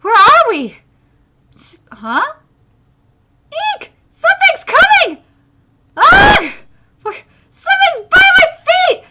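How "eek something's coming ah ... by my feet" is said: startled fright. Acted. Something in the grass.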